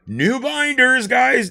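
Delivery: excited. Real, not acted. Excited at new cards.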